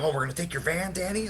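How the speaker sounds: a hint of a taunting buddy voice; mocking drunk friend. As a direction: teasingly quote the pushy friend, rising at the end. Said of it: "He's like".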